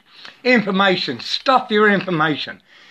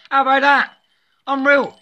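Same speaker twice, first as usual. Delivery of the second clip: amazed; 8 semitones higher, 3 dB louder than usual. Real, not acted. Amazed after laughing.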